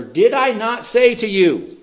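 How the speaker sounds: high, slow, loud exasperated question; a little frustrated. Acted. Jesus answering a doubting sister, mildly frustrated and emphatic. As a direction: push it up high, spelling it out in frustration. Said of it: "Does he sound a little frustrated?".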